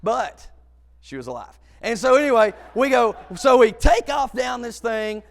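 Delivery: ordinary delivery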